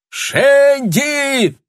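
high, long calling yell; distant shout. A narrator's reading. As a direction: call out from afar. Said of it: "донёсся крик из толпы".

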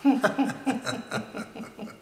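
airy high-pitched giggle; high breathy laugh. Acted. Poet laughing at the scientists' surprised reply, high and airy. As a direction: laugh it off lightly.

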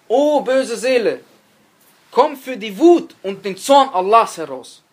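loud, drawn-out command; slow, forceful call with heavy stresses; stern summons. Acted. Angel of death summoning an evil soul, stern and commanding.

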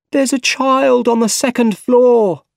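high, loud alarmed cry. A narrator's reading. Bystander at a burning building, crying out. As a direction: raise the alarm, urgent. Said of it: "someone cried".

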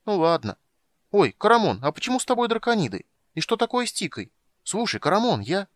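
high, quick and chirpy; bright young kender, very high. Acted. Tas voiced high.

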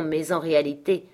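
plain reading voice

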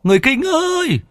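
very high, long carrying call. Acted. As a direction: cup hands and call across the forest. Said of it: "trụm tay lên miệng mà hét".

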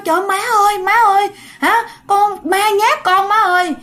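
high, panicked calls to mother; frightened calling. Acted. Sister-in-law calling her mother about the ghost, frightened.

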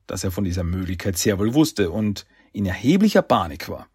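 usual speaking voice

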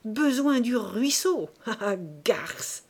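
slow, loud, dragging out "besoin" with a bitter laugh; enraged husband insulting his wife's lover; furious stammer. A narrator's reading. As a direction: spit it out slowly, then a nasty laugh. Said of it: "balbutia-t-il".

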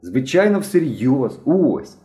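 put-on perky, provocative boy voice; cocky. A narrator's reading. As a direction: insist with cheeky confidence. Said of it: "задирикувато продовжував".

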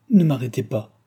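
plain reading voice

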